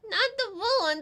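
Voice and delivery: incredulous outburst; mock outrage. Real, not acted. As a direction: protest that clip loudly. Outraged at the clip choice.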